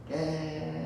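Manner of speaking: high mock stoner voice. Stoner answering the cop.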